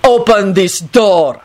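slow, emphatic command; curt authority, drawn out slowly; impatient order. A narrator's reading. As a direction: space the words out with impatience. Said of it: "told the fat lady impatiently".

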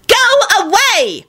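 drawn-out, very high shriek; high-pitched exasperated screech. A narrator's reading. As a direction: screech it slowly to drive him off. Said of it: "shrieked Elizabeth".

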